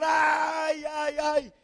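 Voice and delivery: high wailing "ay, ay, ay"; lamenting cry. Retold or imitated. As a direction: wail like a man in sackcloth. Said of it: "comenzó a gritar".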